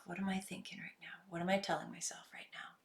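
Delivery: a hushed question to yourself, almost a whisper; quiet self-questioning. Shown as a demonstration. Coach demonstrating asking yourself quietly. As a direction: ask it softly, barely voiced.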